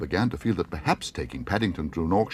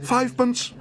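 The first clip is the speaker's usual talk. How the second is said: very high excited cry; 4 dB louder than usual. Acted. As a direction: blurt it out eagerly. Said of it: "cried Paddington excitedly".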